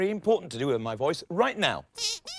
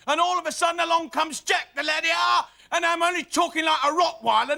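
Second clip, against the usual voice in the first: put-on London accent; 7 semitones higher than usual. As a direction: bellow in broad London at Rottweiler volume. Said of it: "a touch of lovely London at Rottweiler volume".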